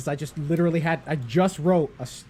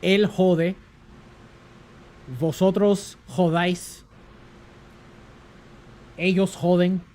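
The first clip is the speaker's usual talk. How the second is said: mock Spanish lesson; 4 semitones higher, 5 dB louder, slower than usual. Conjugating a Spanish curse verb.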